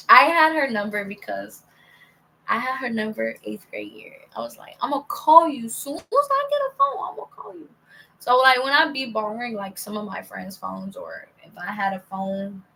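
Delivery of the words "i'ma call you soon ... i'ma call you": higher, lightly squeaky promising voice; eager promise. Retold or imitated. Promising her old teacher she'll call, a high eager voice. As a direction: pitch up like a kid making a promise.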